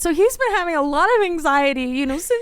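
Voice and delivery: hammed-up wounded complaint; mess on the phone. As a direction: plead it as if near tears. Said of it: "I was a mess on the phone".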